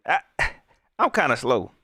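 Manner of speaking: ordinary talk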